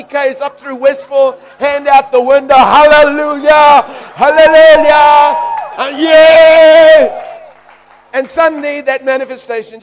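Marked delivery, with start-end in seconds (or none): 2.6-7.2 loud, slow, stretched hallelujahs; ecstatic hallelujahs. Retold or imitated. Preacher shouting praise driving through Westville. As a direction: shout it out the car window.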